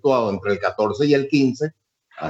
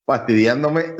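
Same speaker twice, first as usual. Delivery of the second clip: normal talking voice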